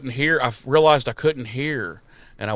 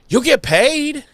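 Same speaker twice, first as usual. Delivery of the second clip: chirpy; 12 semitones higher, 4 dB louder, slower than usual. Retold or imitated. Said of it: "he says that to her like".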